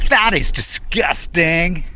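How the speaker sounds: high, drawn-out American accent, imitating daughter. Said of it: "in an American accent".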